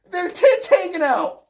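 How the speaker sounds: crying out in disbelief; incredulous repeat. Real, not acted. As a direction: repeat it in amused outrage, voice jumping up. Incredulous repeat while breaking into laughter.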